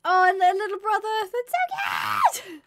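high, drawn-out cooing squeal; delighted squeal. Real, not acted. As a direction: gush over the little brother. Another baby animal.

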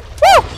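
high falsetto woo; quick high whoop on the jump; high-pitched whoop. Real, not acted. Teleporting past game enemies, high whoop.

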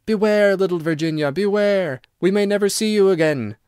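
high, eerie creature voices; eerie warning. A narrator's reading. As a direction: wail an ominous warning.

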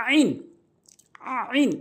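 "আইন" as Arabic ayn from the throat; careful throat-made "আইন"; আইন demonstration. Shown as a demonstration. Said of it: "এই হরফটি ভুল বলে থাকি". ("আইন", আরবি হরফের সঠিক উচ্চারণে)

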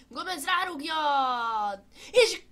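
goal call, high and loud; excited Hungarian sports commentary. Pretend. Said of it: "én vagyok a kommentátor".

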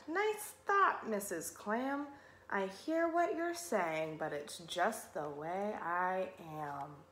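resigned glum fish. Acted. Pout-pout fish answering Missus Clam, glum resignation. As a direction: let the excuse droop away softly.

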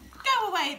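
high, loud dismissal; quacking ducks. A narrator's reading. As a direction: shoo him off as a quacking duck. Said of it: "they quacked".

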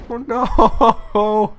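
high, drawn-out wail; dismayed wail. Real, not acted. Player losing the fight, high dismayed wail. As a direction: wail it high as things go wrong.

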